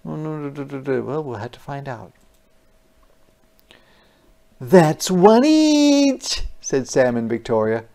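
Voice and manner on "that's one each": put-on child's voice. Kids counting their cookies.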